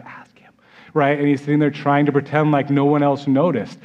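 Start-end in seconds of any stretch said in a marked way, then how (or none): 0.0-0.5 barely audible, furtive hint; subtle prompt. Acted. Peter secretly signalling John across the table, almost silent. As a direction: slip it out under your breath, extremely soft. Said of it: "a little more subtle than that".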